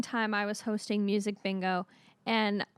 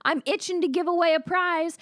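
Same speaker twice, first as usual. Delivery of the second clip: high, loud host voice; hosting hype; 6 semitones higher, 3 dB louder than usual. Retold or imitated. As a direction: rev up the crowd. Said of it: "And I said".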